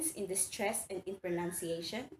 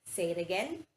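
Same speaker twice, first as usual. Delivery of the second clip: normal talking voice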